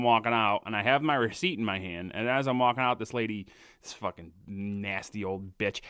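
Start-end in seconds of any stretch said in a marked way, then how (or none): none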